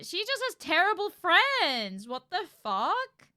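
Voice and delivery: loud, slow, high outrage at the friends; outraged. Real, not acted. Streamer outraged at the friends betraying Amanda, loud high shout. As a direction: shout your disbelief, stretching each word.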